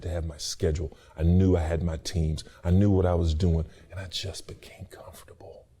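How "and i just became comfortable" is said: whisper. Real, not acted. Confession, dropped to whisper.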